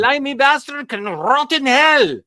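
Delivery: put-on high, loud Lugosi rant in a Slavic accent; terrible Slavic accent. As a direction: spit it out in a bad Slavic accent. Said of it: "I'm doing a terrible Slavic accent".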